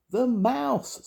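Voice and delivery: very high, forceful mouse squeak; loudest squeak. A narrator's reading. Sam repeating for deaf Grandma, loudest squeak. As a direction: squeak it out with full effort. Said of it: "in his very loudest squeak".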